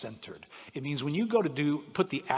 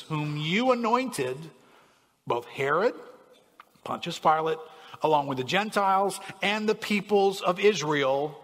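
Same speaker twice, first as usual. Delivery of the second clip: lightly raised, solemn Bible reading; scripture reading; 3 semitones higher, 4 dB louder than usual. Acted. Pastor reading scripture to the church, solemn. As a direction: read the passage like a prayer. Reads Acts 4 prayer aloud.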